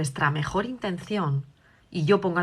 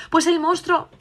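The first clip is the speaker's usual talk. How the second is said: put-on much higher, louder child voice; scared little girl; 10 semitones higher, 5 dB louder than usual. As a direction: answer like a frightened small girl. La hija responde asustada. (niña asustada, voz aguda y fuerte)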